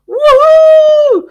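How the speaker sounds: cheer. Real, not acted. Cheering a coming cruise.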